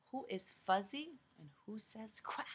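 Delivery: whispered riddle; soft secret question. Acted.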